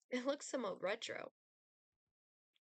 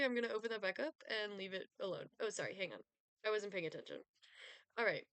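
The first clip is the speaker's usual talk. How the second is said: ordinary talk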